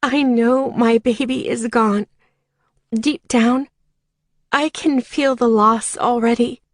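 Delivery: usual speaking voice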